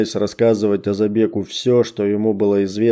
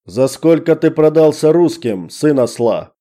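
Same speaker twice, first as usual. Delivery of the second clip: abrupt angry yell; sudden shout; 6 semitones higher, 4 dB louder than usual. Acted. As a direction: snap into a loud shout. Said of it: "Резко меняя интонацию, крикнул".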